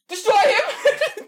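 shrieked order through laughter; laughing shout. Pretend. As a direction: scream the command, cracking up. Pirate orders attack, laughing.

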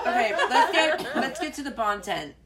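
laughing while trying to go on. Real, not acted. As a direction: fight through the giggles. Still laughing, trying to continue.

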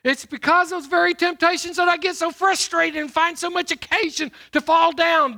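loud, ringing pulpit delivery; booming sermon voice; preaching at full force. Acted. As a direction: preach it out to the congregation.

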